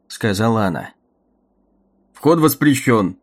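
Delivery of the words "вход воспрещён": slow, cold and metallic; a guard speaking like a machine. Acted. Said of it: "ответил охранник металлическим голосом".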